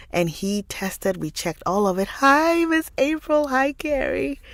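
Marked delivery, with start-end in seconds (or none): 2.1-3.5 high, loud sing-song hello; bright greeting. Real, not acted. Vlogger greets a viewer in chat, delighted. 3.5-4.4 bright greeting. Real, not acted. Vlogger greets a viewer in chat, delighted.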